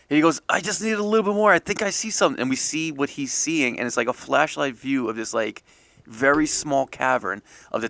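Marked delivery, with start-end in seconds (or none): 0.5-2.4 higher, fast and urgent; hurried plea for a little more slack; eager friend. Acted. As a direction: rush it out, peering closer. Dan voiced peering into hole.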